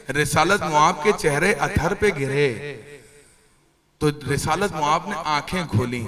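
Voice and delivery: ordinary delivery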